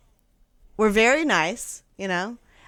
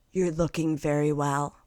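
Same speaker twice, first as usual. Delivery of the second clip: soft, lowered condescending pity; hushed, patronizing imitation; patronizing sympathy; 4 semitones lower, 7 dB quieter than usual. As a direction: coo it down at her with false concern. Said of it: "sympathetically and patronizingly".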